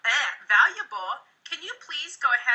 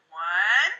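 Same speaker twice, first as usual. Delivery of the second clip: drawn out, climbing much higher; silly teasing count; 6 semitones higher, much slower than usual. Shown as a demonstration. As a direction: tease them with nonsense numbers. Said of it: "I'll go".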